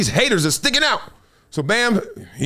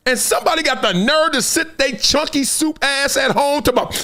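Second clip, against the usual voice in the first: normal talking voice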